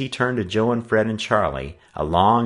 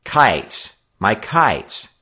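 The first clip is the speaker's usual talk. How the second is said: bold; 3 semitones higher, 5 dB louder, much slower than usual. A narrator's reading. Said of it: "Joe spoke up boldly".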